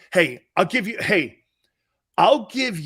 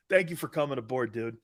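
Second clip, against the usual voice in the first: ordinary delivery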